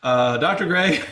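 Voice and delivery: retold plea with a laugh; half-laughing disbelief at the numbers; chuckling through the question. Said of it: "And I'm going".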